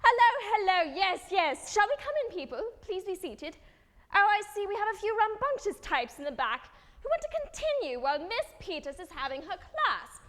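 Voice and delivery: polite but pointed call to order; teacher addressing class. Acted. Teacher settling a rowdy class at the start, sing-song. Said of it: "that's like this teacher".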